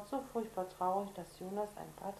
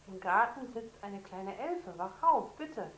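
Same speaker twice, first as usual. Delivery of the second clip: pleading; 7 dB louder, slower than usual. Acted. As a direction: beg her to get up. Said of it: "fleht Jonas". (Jonas fleht seine Schwester an, laut)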